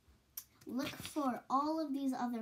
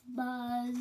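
Fly Guy buzz. Acted. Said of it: "Fly Guy could say the boy's name".

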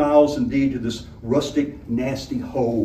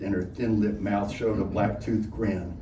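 ordinary delivery, nothing marked

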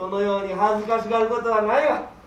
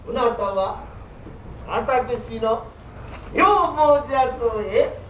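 ordinary delivery

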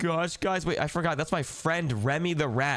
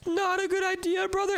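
high alarmed protest; 14 semitones higher than usual. Acted. Alarmed at brother.

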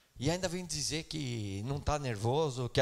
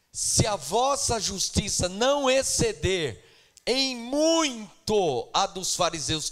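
intoned scripture quote; 5 semitones higher, 7 dB louder, slower than usual. Acted. Preacher quoting Jesus intoning.

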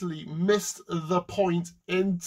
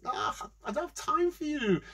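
higher-pitched impatient dismissal; irritated brush-off; 11 semitones higher than usual. Acted. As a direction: wave the beggar away, exasperated. Rich man brushes beggar off.

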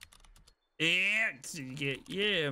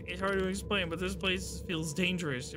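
ordinary delivery

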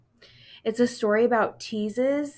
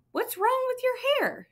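much higher, sing-song grandmother; grandma voice. Acted. Grandma Rose asking Simon about his hair, high grandma voice. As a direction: lift way up into a sweet grandma voice.

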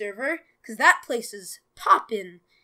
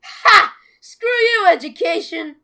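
much higher, loud defiant cheer; gleeful shout; 5 semitones higher, 13 dB louder than usual. Real, not acted. Gleefully skipping the assignment.

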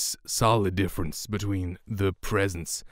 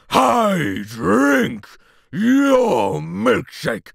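higher, louder roar of triumph, dragged out slowly; acted shout; 12 semitones higher, 8 dB louder, much slower than usual. Oilman bellows at Eli that he drank his milkshake. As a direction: explode with the milkshake line. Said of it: "and then acting".

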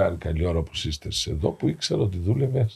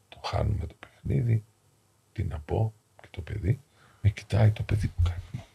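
normal talking voice

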